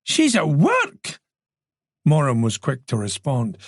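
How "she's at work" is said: slow, high-pitched disbelief; fail to hide your incredulity; drawn-out, astonished exclamation; incredulous. A narrator's reading. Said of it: "tried to keep incredulity out of his voice".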